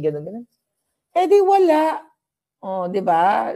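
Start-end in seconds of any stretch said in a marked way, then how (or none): 1.2-3.5 a much higher, louder "Eh, di wala"; playful brush-off; mock innocent. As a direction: shrug it off in a high voice. Joking reply to friends.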